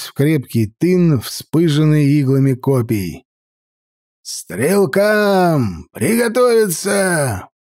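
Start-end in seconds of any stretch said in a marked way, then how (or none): 4.3-7.5 high shouted "Стрелкам! Приготовиться!" drawn out; gruff barked parade orders. Acted. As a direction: shout the archers ready. Commander orders archers ready.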